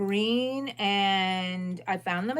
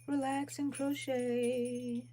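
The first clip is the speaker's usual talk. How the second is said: slow, higher and softer, words stretched; drawn-out repeat; 4 semitones higher, 5 dB quieter, slower than usual. Real, not acted. Crocheter musing to herself on livestream, slow drawn-out words. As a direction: stretch "Relax and crochet" out slowly and softly.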